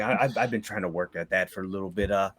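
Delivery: very low, soft and halting; sheepish confession. Acted. Quest-giver owning up to his temper, sheepish and subdued. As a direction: stammer it quietly, ashamed.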